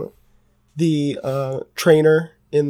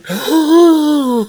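big, high-pitched acted gasp; excited gasp; 11 semitones higher, 4 dB louder than usual. Player acts out Alandi's thrilled reaction, excited gasp. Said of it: "Alandi literally excitedly".

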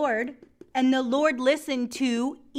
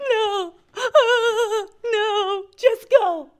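high, loud mock crying plea; overacted tearful refusal to hand him over; hammed-up distraught mother; 10 semitones higher, 8 dB louder, slower than usual. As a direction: wail it theatrically, gasping between pleas. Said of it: "Do you like my acting tonight".

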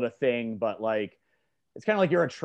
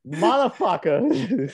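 slow, loud, high mock-Boston drawl; mimicked Boston accent; 7 semitones higher, 5 dB louder, much slower than usual. Cohost copying the coach's swearing, mock Boston accent. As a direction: draw it out in imitation Boston.

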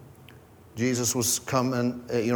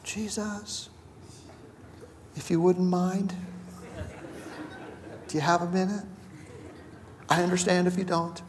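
1 timid asker; 5 semitones higher, 9 dB quieter than usual. Retold or imitated. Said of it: "Whereas someone else".

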